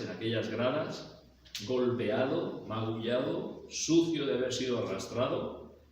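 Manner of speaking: ordinary talk, nothing marked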